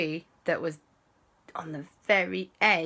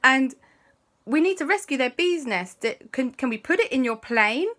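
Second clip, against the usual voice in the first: put-on urgent kids; 7 semitones higher, 4 dB louder than usual. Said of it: "Alexander and Christopher and Auntie Lisa said".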